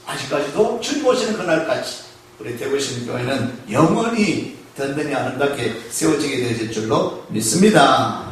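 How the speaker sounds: ordinary talk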